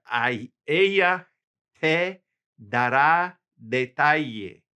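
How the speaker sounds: ordinary delivery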